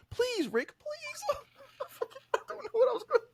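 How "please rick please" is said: squeaky-high, hammed-up begging; mock pleading girlfriend. Girlfriend caught cheating begs her man, mock whimper. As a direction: fake a whimpering plea, way up high. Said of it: "when she get caught cheating".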